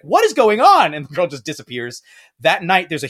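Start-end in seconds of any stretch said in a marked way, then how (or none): 0.0-0.9 high, loud baffled outburst; bewildered Dorothy. Retold or imitated. Dorothy as the blonde girl leaves a pumpkin, startled high outburst. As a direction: demand an answer, thrown and confused. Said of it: "And Dorothy's like".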